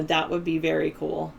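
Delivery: normal talking voice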